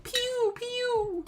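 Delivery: high-pitched "pew" sound effects; playful high zooming noises; imitated flying noise. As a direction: squeal out cartoon whooshes, far above normal voice. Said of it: "all they hear is the beating of wings".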